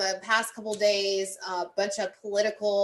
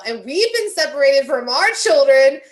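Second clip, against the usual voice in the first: loud, raised voice, hammering the point; angry shout; 4 semitones higher, 12 dB louder than usual. Real, not acted. Caller outraged at the double standard, shouting.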